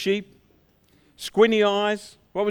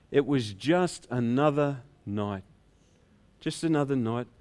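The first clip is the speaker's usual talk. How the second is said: weary routine; 7 semitones lower, 4 dB quieter than usual. Acted. Shepherd telling family about work, low and weary. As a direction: let it sag into resignation. Shepherd shrugs off his night.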